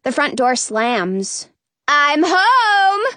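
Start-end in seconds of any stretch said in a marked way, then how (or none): 1.9-3.0 high, sing-out arrival call; drawn-up queenly holler. A narrator's reading. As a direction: announce yourself through the whole house.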